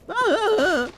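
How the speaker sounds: very high cracking yelp; squeaky high scream; cracked yelp. Real, not acted. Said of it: "Voice cracks".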